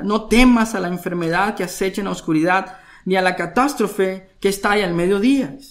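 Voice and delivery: loud, forceful reading of the psalm; preaching scripture. Acted. Preacher proclaiming the psalm to the congregation, loud and forceful. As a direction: proclaim each promise to the congregation.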